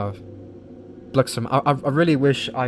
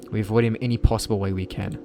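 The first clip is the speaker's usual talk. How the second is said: normal talking voice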